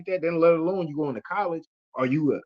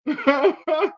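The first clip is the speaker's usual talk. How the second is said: burst of laughter; 13 semitones higher, 5 dB louder than usual. Real, not acted. Laughing at own story.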